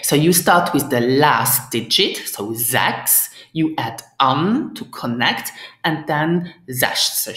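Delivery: ordinary talk, nothing marked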